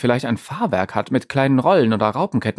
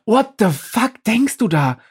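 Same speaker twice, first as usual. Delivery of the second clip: outraged disbelief; 9 semitones higher than usual. Acted. Pablo ranting at his own strange thought, high and indignant.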